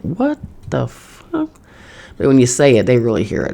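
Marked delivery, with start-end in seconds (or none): 0.0-1.5 high, slow, drawn-out disbelief; stunned what the fuck. Retold or imitated. Reacting to an ad for her thought, high slow disbelief. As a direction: stretch it out in shock, pitched way up. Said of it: "you're like".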